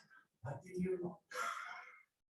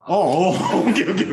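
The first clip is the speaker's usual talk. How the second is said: laughing; 9 dB louder, much faster than usual. Real, not acted. Laughs at student's answer.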